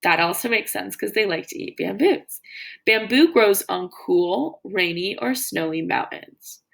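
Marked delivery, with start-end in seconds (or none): none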